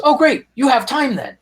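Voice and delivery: high, bright imitation of the eager fan; eager superfan. Norwegian superfan to his passengers, a lightly voiced eager tone. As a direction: play the delighted fan with a sudden plan. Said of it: "and he goes".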